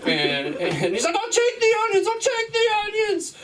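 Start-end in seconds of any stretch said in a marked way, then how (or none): 1.2-3.3 very high, laugh-cracked cry; high hammed-up wail of surrender; screaming surrender. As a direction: give up in a screaming panic. Clerk gives in screaming.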